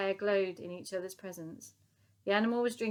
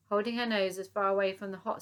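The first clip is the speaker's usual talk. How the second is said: ordinary delivery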